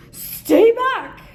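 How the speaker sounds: scared duck yelling at the creature, panicked; frightened cry. Acted. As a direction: yelp it to keep the beast away. Duck gasps at lurching creature.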